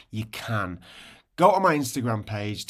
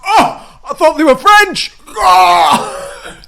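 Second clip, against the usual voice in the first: much higher, shouted mock astonishment; childhood shock; 18 dB louder, slower than usual. Host mocking his younger self watching advert, shocked shout. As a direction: yell it like a kid betrayed by an advert. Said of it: "it's me at home like this".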